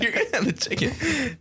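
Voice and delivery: can't get the line out without laughing; laughing mock stranger's line; laughing through the quote. Same quote repeated, laughing, much higher.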